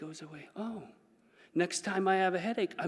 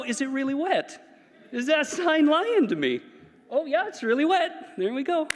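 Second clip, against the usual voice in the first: high, laughing curiosity, testing the paint; excited curious toucher; 11 semitones higher, 5 dB louder than usual. Acted. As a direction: giggle as you check if it's really wet.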